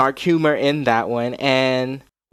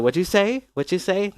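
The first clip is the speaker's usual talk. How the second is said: acted repeated question; 7 semitones higher than usual. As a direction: act out asking someone to repeat. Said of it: "I had to repeat myself".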